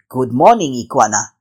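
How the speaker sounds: lightly voiced big snake; deep snake voice. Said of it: "The big snake raised his head and said".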